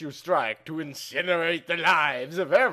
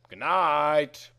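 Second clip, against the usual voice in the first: ordinary delivery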